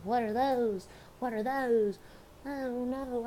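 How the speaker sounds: high mocking girl's voice; mock sister voice. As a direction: parody the sister's silly question.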